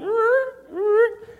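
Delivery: high-pitched grinding engine whine; imitated car noise. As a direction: mimic a failing car's noise. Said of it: "I heard it going".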